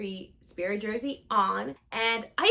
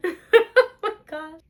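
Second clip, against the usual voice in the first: high, loud laugh into a slow Oh my God; cracking up at her own slip; 7 semitones higher, 7 dB louder, slower than usual. Real, not acted. Laughing at her own mistake while filming, slow and high.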